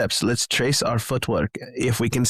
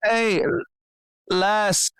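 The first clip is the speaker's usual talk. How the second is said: high, hollering call into the distance; 9 semitones higher than usual. Acted. Dwarf calls into the fog for the missing raven girl, high and hollering. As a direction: shout across the fog for the lost companion.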